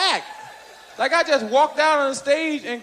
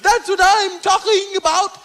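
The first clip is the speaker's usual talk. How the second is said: mock angry Cosby; 3 semitones higher, slower than usual. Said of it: "Bill got pissed and said".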